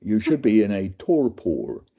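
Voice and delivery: torpor with American vowels; American doctor. Retold or imitated. Doctor warns patient, American accent. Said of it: "I've never forgotten the way he said that".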